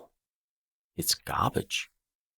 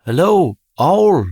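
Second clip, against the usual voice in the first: high, loud call; yell again; 10 semitones higher, 13 dB louder than usual. Acted. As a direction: call out louder into the tree. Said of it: "He yells again".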